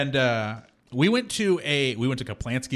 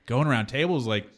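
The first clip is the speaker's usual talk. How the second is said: ordinary delivery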